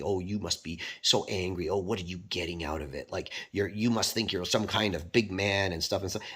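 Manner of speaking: soft, mocking imitation of a shaming stranger. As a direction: quietly parody the preachy scold. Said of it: "shaming language".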